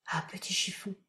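very soft unvoiced whisper; murmured in a kiss. A narrator's reading. Uncle murmurs to Chiffon while kissing her. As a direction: breathe it against her. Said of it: "il murmura dans un baiser".

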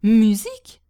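loud, astonished one-word question; startled delight. A narrator's reading. Hostess jumping in her chair at word of music, thrilled surprise. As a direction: pounce on the word. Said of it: "en sautant sur sa chaise".

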